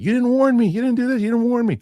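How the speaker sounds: high, loud mock whine of the wife; wife complaining. Husband imitating his wife's high whine. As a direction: squeal it like an upset wife. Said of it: "She's like".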